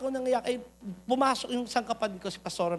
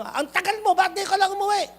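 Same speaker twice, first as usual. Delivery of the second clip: mock yell of an angry mother, pitched higher; mother scolding; 8 semitones higher, 4 dB louder than usual. Mother scolding her late child, a mild mock yell. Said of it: "yung pagsigaw nung nanay niya".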